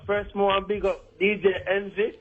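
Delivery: fake Jamaican accent. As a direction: put on a thick Jamaican accent. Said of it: "Could you stop with the fake accent".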